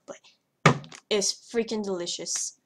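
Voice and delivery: ordinary talk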